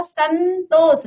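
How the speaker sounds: drawn out slowly; teacher's slow demo of Santosh; slow model reading. Shown as a demonstration. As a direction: stretch it for the class. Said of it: "अब इसका उच्चारण कैसे होगा".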